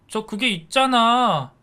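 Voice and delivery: high, slow stammering excuse; flustered son. A narrator's reading. Son stammering excuses to his angry father, high and flustered. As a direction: scramble for an excuse, scared.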